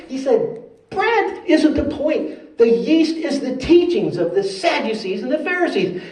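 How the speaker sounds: high, emphatic scolding; animated teacher losing patience, pitched high; exasperated Jesus. Acted. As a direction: reprove them with high-pitched incredulity.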